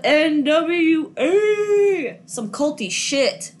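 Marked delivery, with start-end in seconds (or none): 0.0-2.2 slow, drawn-out mock cult chant; mock-ominous proclamation. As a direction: draw it out high and grand. Mock-grand naming of cult.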